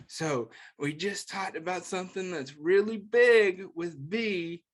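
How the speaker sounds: usual speaking voice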